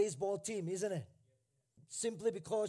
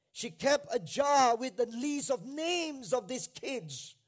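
usual speaking voice

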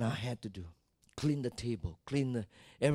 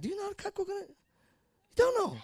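teasing challenge. Acted. Teasing young people.

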